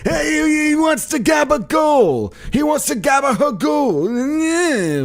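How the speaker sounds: very high, shouted, drawn-out waiter voice; hammed-up shouted waiter; Andrew Dice Clay waiter. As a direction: yell it like a brash waiter. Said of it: "Andrew Dice Clay as your waiter".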